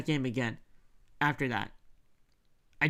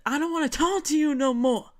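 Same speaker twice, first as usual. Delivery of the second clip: mock upset player; 9 semitones higher than usual. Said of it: "He's like".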